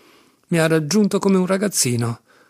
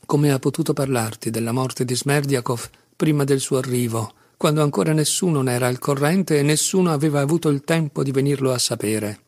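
ordinary delivery

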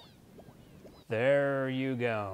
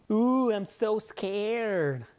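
mock fear; 7 semitones higher, 4 dB louder, much slower than usual. Mocking reply to threat.

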